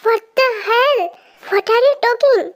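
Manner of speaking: high, drawn-out disbelief; shocked outrage. Acted. She hears his demand for a kiss, shocked outrage. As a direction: stretch out the words in shocked protest.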